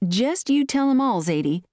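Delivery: lightly voiced country mother, starting high; a hint of motherly prodding. A narrator's reading. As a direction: tell the daughter in a folksy lilt. Mother instructs daughter.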